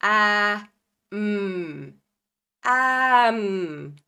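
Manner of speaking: two sounds then blended; blending demo. Shown as a demonstration. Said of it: "am is the word".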